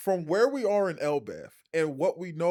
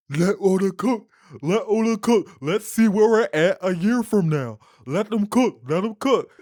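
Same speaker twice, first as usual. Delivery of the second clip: louder, higher confident chant; mock hype voice; 5 semitones higher, 7 dB louder than usual. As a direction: brag like a hype man. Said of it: "were like".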